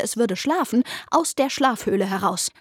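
usual speaking voice

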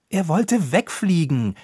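defensive. A narrator's reading. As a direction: justify it, raising the voice. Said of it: "verteidigte sich Ropucha".

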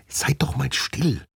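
whispered hush, slow and high; urgent shushing whisper. Acted. Nina hushes her friends to listen, urgent whisper.

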